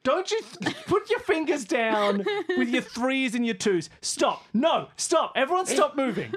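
loud, high, half-laughing pleas to stop; exasperated shouting. Real, not acted. Player yelling at teammates to stop signalling, exasperated and loud. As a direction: yell at everyone to stop gesturing.

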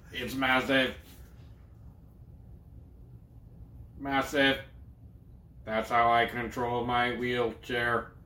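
slow, flat robotic monotone; Stephen Hawking voice. Pretend. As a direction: speak like a speech synthesizer. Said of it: "This is my Stephen Hawking voice".

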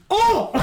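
very high yelp of surprise; a sudden squeaky oh! through laughter; mock startled oh. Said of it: "He goes, he goes".

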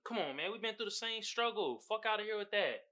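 normal talking voice

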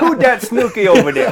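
Who dat chant, high and loud; New Orleans accent. Pretend. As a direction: belt it like a Saints fan. Mock New Orleans Saints chant.

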